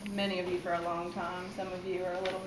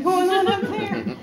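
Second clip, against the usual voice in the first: talking through laughter, rising higher and louder; laughing; 8 semitones higher, 8 dB louder than usual. Real, not acted. Poet joking to the audience about son's hair, laughing. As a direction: let the laugh push the voice up.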